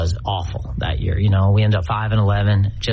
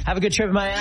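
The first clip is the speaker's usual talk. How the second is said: overly sweet, mock-friendly goodbye; sarcastic farewell; 11 semitones higher, 3 dB quieter than usual. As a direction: chirp it with fake cheer.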